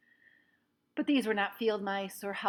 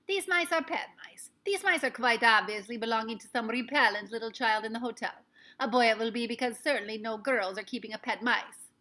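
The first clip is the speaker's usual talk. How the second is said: louder, haughty witch voice; 3 semitones higher, 7 dB louder than usual. A narrator's reading. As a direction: sneer at the child. Grand High Witch explains.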